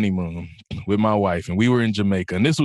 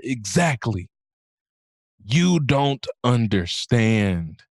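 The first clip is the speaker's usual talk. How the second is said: grave correction; much slower than usual. Retold or imitated. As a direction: let each word land like a lesson. Acted specialist's solemn answer to her.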